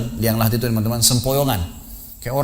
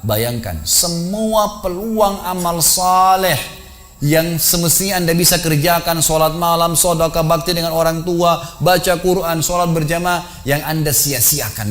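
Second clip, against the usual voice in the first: high, drawn-out preaching voice; impassioned exhortation; 5 semitones higher, slower than usual. Retold or imitated. Preacher urging the man in the shroud to imagine, impassioned high exhortation. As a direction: press each item home in a sustained raised pitch.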